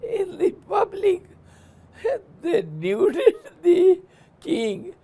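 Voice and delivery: can't stop laughing as the king stands nude; giggling storyteller; laughing while telling. Real, not acted. Laughing through the climax.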